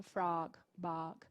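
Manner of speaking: frog and bog with the open vowel; soft model of frog, bog; model pronunciation. Shown as a demonstration. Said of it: "Seria uma coisa assim". (frog e bog com vogal aberta)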